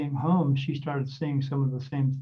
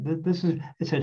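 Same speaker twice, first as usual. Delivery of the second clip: normal talking voice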